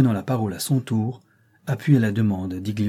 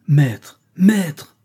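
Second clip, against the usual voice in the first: higher, louder pleading cry; 5 semitones higher, 7 dB louder than usual. A narrator's reading. Méa crying out to the sultan, pleading loudly. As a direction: cry out for the master's attention. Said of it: "criait-elle au sultan".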